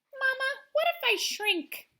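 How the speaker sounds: put-on high, small child's voice asking mama; a fretful kid's plea; worried little girl. Wimberly's worried question to mother.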